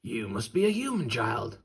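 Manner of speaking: lightly voiced friendly wolf; a hint of a curious wolf. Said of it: "said the last wolf".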